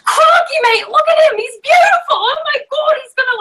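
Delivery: over-the-top Australian nature-show shout; Steve Irwin impression. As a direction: yell it like a thrilled Aussie croc hunter. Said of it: "this Australian guy screaming across the room".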